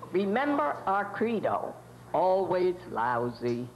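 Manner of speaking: deep slow slogan. Acted. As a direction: drop low and slow on always lousy. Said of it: "nineteen twenties radio station studio".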